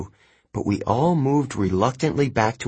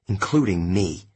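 ordinary delivery, nothing marked

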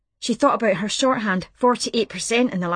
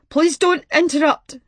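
louder, pleading don't; tearful plea; 7 semitones higher, 5 dB louder than usual. A narrator's reading. Grieving caller silencing Martha, louder tearful plea. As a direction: beg her not to cut in. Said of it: "Something in his voice kept Martha quiet".

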